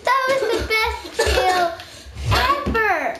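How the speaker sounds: high, loud post-fight excitement; thrilled exclaiming. Real, not acted. Player celebrating the best kill, thrilled. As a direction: crow it loudly with laughter in it. Exulting after an intense fight.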